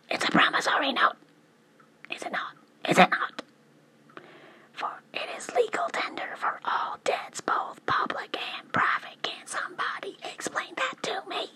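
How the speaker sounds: loud, high and forceful; drill sergeant voice. Real, not acted. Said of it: "Why are you talking like drill sergeant?".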